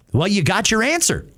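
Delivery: ordinary talk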